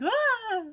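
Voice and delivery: a startled high yelp; nervous yelp. Retold or imitated. Garfield acting out nervousness with a jumpy yelp. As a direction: yelp like you're scared of your own shadow. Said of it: "Or nervous".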